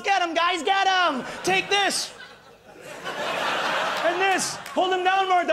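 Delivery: rally the troops in a silly fight; mock battle cries.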